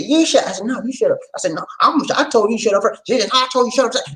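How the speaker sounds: high, teasing back-and-forth; playful mock bicker. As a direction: bicker like kids.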